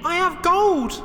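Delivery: squeaky falsetto shout; mock shout. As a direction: call out triumphantly in a very high voice. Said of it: "shouted Jack".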